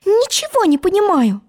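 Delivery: put-on high, clear girl's voice; puzzled Alice. Alice to the croaking frog, high baffled voice. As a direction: say it pitched far up, baffled. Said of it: "сказала она".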